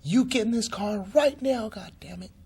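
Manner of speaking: high, loud motherly order, snapped out; angry mom scolding. Retold or imitated. Stranger orders injured man into her car, angry mom voice. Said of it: "like she's my mom, really mad".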